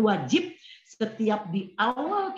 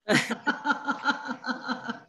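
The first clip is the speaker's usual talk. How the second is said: light giggle; 7 semitones higher than usual. Real, not acted. Teasing Chandra for smiling.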